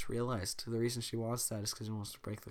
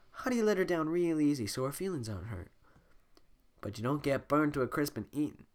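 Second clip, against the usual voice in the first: ordinary talk, nothing marked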